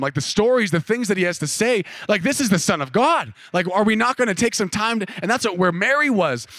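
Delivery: ordinary talk, nothing marked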